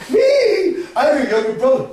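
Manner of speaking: squeaky high "me?", all innocence; innocent surprise. Acted. Krishna answering Dauji's accusation, mock-innocent surprise. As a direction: play the wide-eyed little brother.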